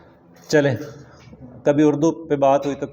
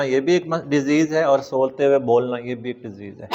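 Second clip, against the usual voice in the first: usual speaking voice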